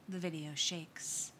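usual speaking voice

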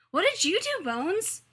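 put-on high, loud, curious kid voice; eager child's cry. A narrator's reading. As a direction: cry out the question like an eager preschooler. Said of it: "Hikaru cried from the front".

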